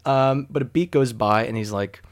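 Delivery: normal talking voice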